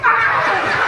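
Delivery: high, loud mock yelp; sudden comic "ah" of pain; pained cry. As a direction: cry out as if snapped again. Snapped again by the balloon.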